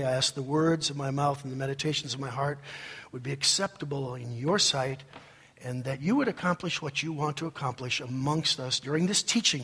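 low, quick, earnest public prayer. Real, not acted. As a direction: pray softly over the congregation. Leads a prayer.

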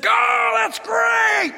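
high, loud excited yell; Jesus cheering. Acted. Cheering on Jonathan from the throne, excited yell. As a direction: leap up and yell encouragement, stretched out. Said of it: "got so excited, Jesus stood".

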